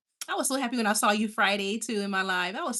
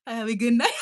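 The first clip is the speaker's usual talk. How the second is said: laughing; 2 semitones higher, 4 dB louder than usual. Real, not acted. Laughing while signing off.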